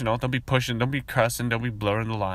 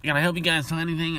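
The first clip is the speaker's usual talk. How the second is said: high, chummy sales pitch; pushy salesman; 7 semitones higher than usual. Retold or imitated. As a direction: sell it like an eager floor rep. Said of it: "their attitude".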